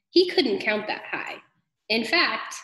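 plain reading voice

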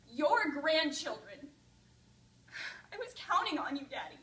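loud, slow, tearful pleading; grieving lament. Acted. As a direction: mourn every missed moment. Grief at father's grave.

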